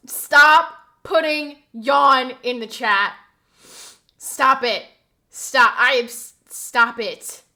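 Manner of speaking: loud, emphatic orders, word by word; exasperated shouting. Real, not acted. Streamer fed up with yawn messages, exasperated shouting. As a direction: hammer each word at chat.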